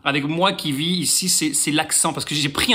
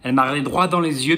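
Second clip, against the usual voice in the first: ordinary talk, nothing marked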